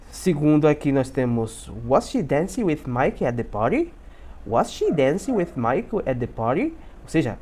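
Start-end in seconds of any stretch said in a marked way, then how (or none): 1.9-6.7 lilting question, voice rising on "party"; question intonation. Shown as a demonstration. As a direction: model "Was she dancing" for learners, ending upward on "party". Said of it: "tem que fazer essa entonação". (pergunta com entonação, subindo em "party")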